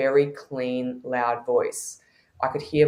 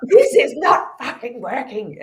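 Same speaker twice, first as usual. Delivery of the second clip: mock indignant protest; 12 semitones higher, 3 dB louder than usual. Ego protesting to the shaman.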